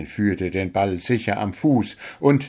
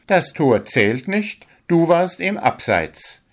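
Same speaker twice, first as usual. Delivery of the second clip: raised, firm referee voice; stern referee; 9 semitones higher than usual. A narrator's reading. Referee Luzi telling the dwarf sternly. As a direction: lay down the offside call sternly. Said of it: "erklärte Luzi streng".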